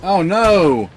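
sudden loud, high "oh no"; alarmed cry. Real, not acted. Gamer sees his tame attacked, alarmed cry. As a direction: yelp in alarm as the fight turns.

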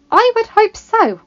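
high, loud, pointed retort; frank. A narrator's reading. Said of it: "Belle said frankly".

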